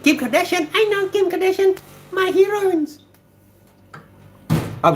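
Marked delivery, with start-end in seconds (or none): 0.0-3.0 very high, squealing fangirl voice, much louder; Pixie's voice. Retold or imitated. Streamer voicing a viewer's comment in a very high squeal. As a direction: gush like a starstruck fan in a tiny high voice. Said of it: "that's not how Pixie sound".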